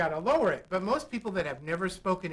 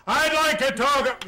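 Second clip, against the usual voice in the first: huge, high, slow booming announcement; hammed-up far-too-loud request; overdone projection; 8 semitones higher, 9 dB louder than usual. Shown as a demonstration. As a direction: blast it out absurdly big. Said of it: "I'm overdoing it".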